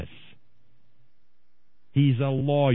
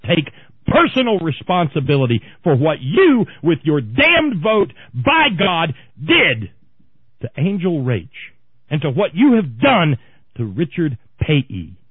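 loud, higher furious tirade; angry screaming; 5 semitones higher, 6 dB louder than usual. Real, not acted. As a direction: hammer every word in fury. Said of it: "you're screaming again".

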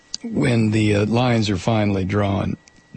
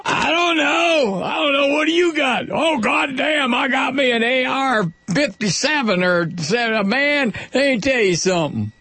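boastful gun nut; 12 semitones higher than usual. Retold or imitated. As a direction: holler proudly about his big gun. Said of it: "I have to do this occasionally".

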